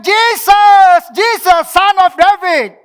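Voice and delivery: high, very loud, drawn-out cry; shouting out desperately for help; blind man's shout. Acted. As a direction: yell to be heard by someone passing. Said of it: "Dia berteriak".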